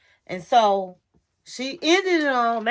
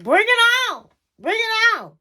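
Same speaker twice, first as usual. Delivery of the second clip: defiant shout; 8 semitones higher, 4 dB louder than usual. Retold or imitated. Retelling own defiant reply.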